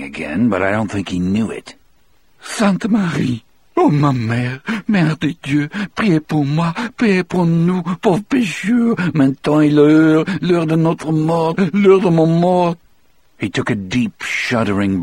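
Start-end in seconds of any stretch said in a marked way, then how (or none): 2.4-12.8 high, pleading Cajun prayer; tearful prayer. Acted. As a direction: beg the Virgin through tears. Delacroix prays, crying.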